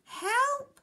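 high, loud cry for help; very high frightened fish; flapping panic. A narrator's reading. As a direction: shriek it in a flap. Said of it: "flapped the fish".

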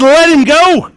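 loud, high crowd cry; crowd shout. Acted. Crowd answers Pilate about Barabbas, shouting loud and high. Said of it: "And the crowd said".

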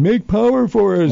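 high-pitched imitation of helpless demanders; mock demanding public. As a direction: plead mockingly, pitch jumping way up. Mimics people demanding power.

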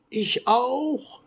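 very high, shrill peeping chick cry; baby chick. A narrator's reading. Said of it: "schrie das Küken".

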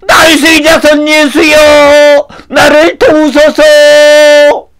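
high, long-drawn plea; desperate shouting. Acted. Blind man over annoyed townspeople to Jesus, desperate shouting. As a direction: wail it out to be heard. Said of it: "이렇게 소리를 치니까".

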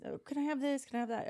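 put-on high, pleading little kid; a whiny kid begging for toys; begging child voice. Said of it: "to buy".

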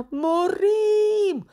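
very high, loud drawn-out wail; panicked howl. Acted. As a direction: howl it as if doomed. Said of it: "Se porni pe urlat". (urlet ascuțit de panică)

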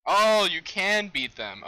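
loud, high, drawn-out "oh"; surprised discovery; sudden realization. Real, not acted. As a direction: stretch it out as it dawns on you. Sudden realization during play.

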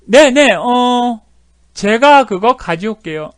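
casual translation. Shown as a demonstration. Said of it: "이런 말이에요".

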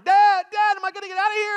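put-on high, loud, panicked child's wail; imitated crying child. Daughter trapped in the seat belt calls to dad, imitated panicked crying. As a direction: wail to dad like a trapped little kid, hammed up. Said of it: "she's just crying".

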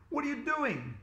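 very high, alarmed demand; panicked question. A narrator's reading. Boy questions dentist about the seatbelt, panicked. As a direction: ask it in a high-pitched fluster.

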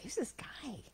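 soft unvoiced whisper; whispered worry. Worried listener asking the crowd about the heckler, whisper. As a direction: hush it to your neighbour.

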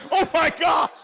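loud, high mock shock. Boy reacting to pigs in the water, mock shocked. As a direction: gasp it out in mock horror. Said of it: "You're like".

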